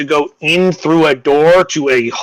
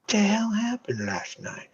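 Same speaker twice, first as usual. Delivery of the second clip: hushed disbelief; 4 semitones higher, 15 dB quieter than usual. Acted. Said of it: "And he goes".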